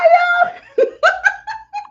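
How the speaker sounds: high squealing burst of laughter; high delighted laugh. Real, not acted. Delighted at fire-like colour.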